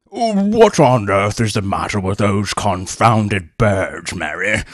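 slow, gruff exclaiming colonel; blustering colonel. A narrator's reading. Colonel annoyed at the screaming macaws, exclaiming. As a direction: bark it like an irritated old colonel. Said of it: "exclaimed Colonel Hampton".

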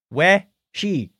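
"We, she" said as two clear syllables, like wet and she; We-she pronunciation. Shown as a demonstration. Host spelling out a listener's surname for listeners, clearly. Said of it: "Pronounced like the first part of wet".

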